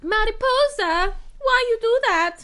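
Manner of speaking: put-on high, loud Eastern European accent. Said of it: "I can't even do it, but they're like".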